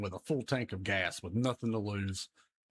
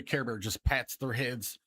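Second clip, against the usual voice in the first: normal talking voice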